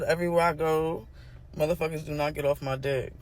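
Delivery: normal talking voice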